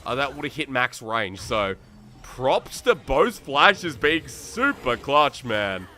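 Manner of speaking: fast, rising esports casting call; live play-by-play. Real, not acted. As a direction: ride the action like a live caster. Solo caster calling the game live.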